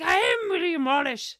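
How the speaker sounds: loud, high proud Scottish declaration; mock Scottish accent. Wallace announces himself to the doubters, mock Scottish and proud. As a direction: boom it in a hammed-up Scottish accent. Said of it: "um, Scottish accent".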